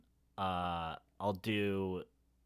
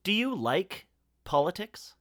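put-on quiz voice; 10 semitones higher, 3 dB louder than usual. Reading a quiz question in a voice.

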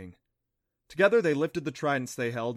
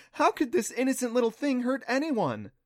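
doting dismissal; 11 semitones higher, 5 dB louder than usual. A narrator's reading. Clancy dismissing danger, doting.

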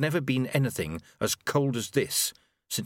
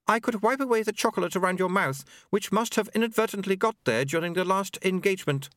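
high, formal, tactful voice; diplomatic; 10 semitones higher than usual. Acted. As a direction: offer it delicately in a high voice. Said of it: "still diplomatically".

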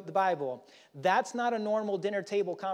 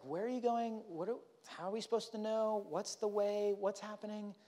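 lost Thomas; 4 semitones higher, 5 dB quieter than usual. Retold or imitated. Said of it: "Kinda like Thomas".

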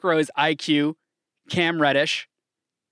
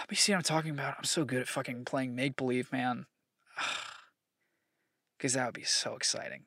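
much softer, almost to himself; dreamy hush; 10 dB quieter than usual. Real, not acted. Host daydreaming about the trade, much softer. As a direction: savor the fantasy quietly.

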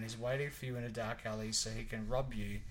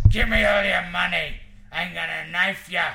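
very loud mugger's shout, pitched up; menacing American badger; 5 semitones higher, 15 dB louder than usual. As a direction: demand it like an alley robber. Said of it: "Whereas the American badger's like".

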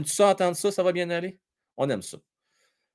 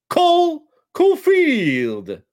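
high, slow, drawn-out name call; announcer; 11 semitones higher, 6 dB louder, much slower than usual. Pretend. (annonceur d'aréna, nom étiré et aigu)

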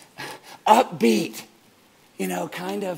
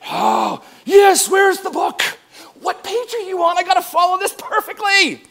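loud, higher, hammed-up eager student; frantic to get every page right; mock overeager; 12 semitones higher, 9 dB louder than usual. Imitating an anxious perfectionist student in a loud, higher mock frenzy.

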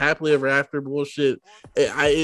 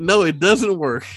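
ordinary talk, nothing marked

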